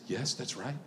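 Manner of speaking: quiet, sheepish reply; meek reply. Retold or imitated. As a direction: answer small and meek. Own meek replies in dialogue.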